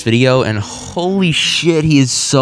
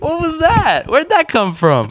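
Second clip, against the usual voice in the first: high shocked disbelief; slower than usual. Real, not acted. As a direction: squeal it out in disbelief. Shocked at the tone switch.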